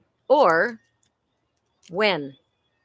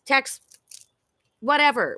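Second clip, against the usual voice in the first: usual speaking voice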